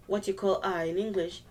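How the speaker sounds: ordinary talk, nothing marked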